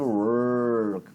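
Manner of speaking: "work" stretched long on a thick curled R; mouthful R. Shown as a demonstration. Teacher exaggerating the R for a student, mouthful sound. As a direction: say "work" as if something is in your mouth. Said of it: "parece que tem alguma coisa na sua boca".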